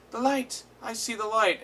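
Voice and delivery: put-on high, amazed kid's cry; high mock wonder; awed cry. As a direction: marvel at the light like a freed kid. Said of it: "one of them's just like".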